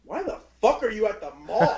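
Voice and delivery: mock furious mother; angry mom. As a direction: snap at the kid over the phone. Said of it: "And she's like".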